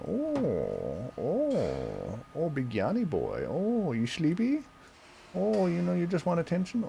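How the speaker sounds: high, sing-song cooing at the cat. Real, not acted. Owner cooing at his sleepy cat, high sing-song baby talk.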